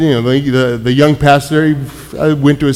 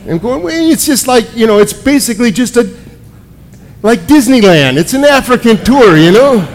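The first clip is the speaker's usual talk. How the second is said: high, eager description; bubbling over with delight, pitched up; excited telling; 7 semitones higher than usual. Retold or imitated. Telling the puzzled counselor excitedly about his vision.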